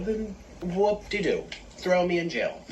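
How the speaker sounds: high, slow mocking whoop-de-doo; drawn-out sarcastic shrug of a phrase; mock-unbothered singsong. Real, not acted. Sarcastic dismissal of critics.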